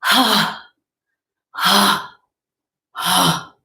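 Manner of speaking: loud, punchy ha sounds to let sadness out; forceful cathartic ha ha ha; loud release ha. Shown as a demonstration. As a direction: push each ha out from the chest. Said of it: "release sadness in a friendly way by going".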